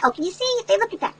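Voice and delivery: ordinary delivery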